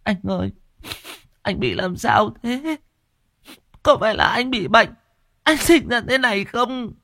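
very high, pleading wife's voice; a frantic wife begging her husband; distraught wife. A narrator's reading. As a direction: shake him and plead, panicked. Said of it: "không giữ được bình tĩnh nữa".

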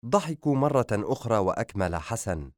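plain reading voice

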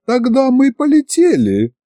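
very high-pitched dragoness; high, excited creature voice; eager dragoness. A narrator's reading. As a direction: announce departure in a high, keen voice.

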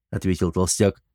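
plain reading voice

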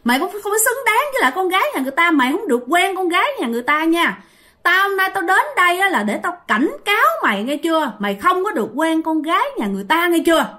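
high, fast scolding voice of the scary woman; high-pitched hostile telling-off; menacing woman's warning. A narrator's reading. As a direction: jab out an angry threat, pitched high and rapid. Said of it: "bả mới chỉ vô mặt bạn, bả mới nói rằng".

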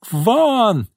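high, drawn hysterical cry; hysterical yell. A narrator's reading. Boss throws secretary out, yelling hysterically. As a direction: scream her out of the room. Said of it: "истерично завопила Изольда".

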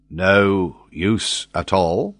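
slow, deliberate voice of a different man; McNabbs' voice. A narrator's reading. McNabbs doubting the captain, in a slow, separate voice. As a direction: drawl the doubt out slowly.

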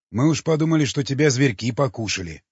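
ordinary delivery

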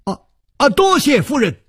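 light up and blurt your thanks; suddenly brightened. A narrator's reading. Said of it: "楚留香的眼睛突然一亮". (眼睛一亮，兴奋地道谢)

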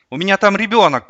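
high, loud desperate cry; shouted. A narrator's reading. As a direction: cry out as a mother being blocked. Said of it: "крикнула Лариса". (крик)

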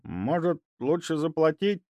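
put-on timid old man asking. A narrator's reading. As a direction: ask hesitantly, unsure. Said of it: "несмело спросил он". (несмело)

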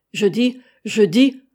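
loud, slow, defiant start; swearing defiance. A narrator's reading. Sailor Penn answering the captain's challenge, swearing defiance. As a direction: curse it out loudly. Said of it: "répondit Penn en jurant".

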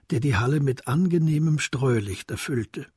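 ordinary talk, nothing marked

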